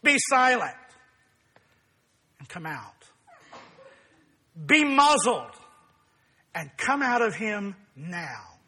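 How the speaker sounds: a hint of a stern Jesus commanding; firm, measured rebuke of the demon; lightly voiced stern Jesus silencing the spirit. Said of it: "Jesus sternly says to him".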